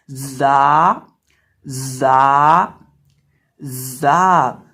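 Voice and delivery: loud जा opening with a buzzing, whistled z; drawn-out, sibilant जा demo; whistling letter. Shown as a demonstration. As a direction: buzz into जा and hold the vowel long. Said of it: "जा सीटी वाले हरूफ में से है".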